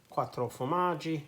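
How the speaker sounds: put-on quattro formaggi rolled out in a playful Italian accent. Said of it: "talking in an Italian voice".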